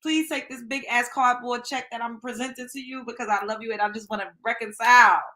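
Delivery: fake-sob a begging sorry to her sister; theatrical pretend crying, pleading for forgiveness; mock crying plea. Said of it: "I be crying".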